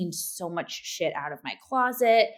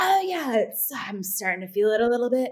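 breathless; 5 semitones higher than usual. Retold or imitated. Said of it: "You are panting".

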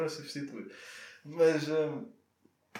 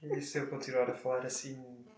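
sensual voice; 5 dB quieter than usual. Pretend. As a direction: play it sultry and quiet. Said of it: "achas que é uma voz sensual".

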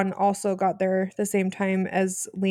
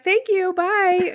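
breezy goodbye; 11 semitones higher, 4 dB louder, much slower than usual. Retold or imitated. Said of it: "they're like".

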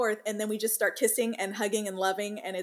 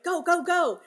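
higher, brisk go go go; tough hurrying mom; 8 semitones higher, 3 dB louder than usual. Retold or imitated. Mom rushing kids in morning, brisk and higher. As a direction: push the kids out the door. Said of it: "I'm so like".

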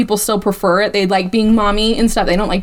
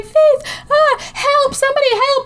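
high, frantic cry for help; panicked call for help. Retold or imitated. A mother calling help for her collapsed child, high panic. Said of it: "she's like".

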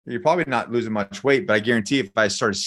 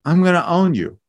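raised pitch, slow, deliberate taunt; cocky threat; 6 semitones higher than usual. Acted. Trained fighter to an untrained attacker, cocky threat. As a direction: stake your dominance over an imagined opponent.

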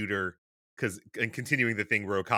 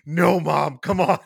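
loud, higher mock plea to mom; exasperated protest; 5 semitones higher, 8 dB louder, slower than usual. Son shushing his chatty mom during the show, exasperated. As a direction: plead like a pestered son, raised voice.

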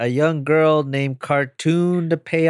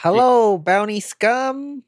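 hammed-up alien greeting; 10 semitones higher than usual. Alien greeting bounty hunters.

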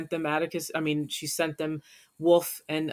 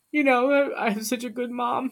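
getting emotional; 8 semitones higher than usual. Real, not acted. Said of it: "oh, I'm getting emotional".

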